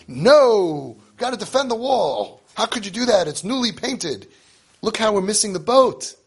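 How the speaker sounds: high, loud hammed-up "No!" with a cry; mock outraged parent. Parents leaping in front of the wall, mock outraged. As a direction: ham up panic over the newly painted wall.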